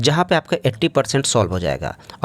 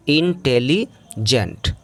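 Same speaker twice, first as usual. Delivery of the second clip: slow demo; much slower than usual. Shown as a demonstration. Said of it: "adjective जैसे आ गया आपका intelligent".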